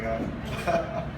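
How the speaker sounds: usual speaking voice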